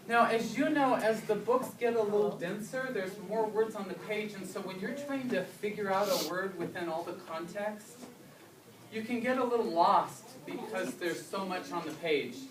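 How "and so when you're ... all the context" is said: ordinary talk, nothing marked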